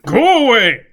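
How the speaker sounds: loud furious go away; angry shout. Acted. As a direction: yell it through the door. Recluse yells again.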